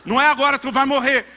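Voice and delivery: high, loud preacher's rebuke to a son; fiery mock rebuke of a grown son; loud retold scolding. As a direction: scold him loudly from the pulpit. Father scolding son on the phone.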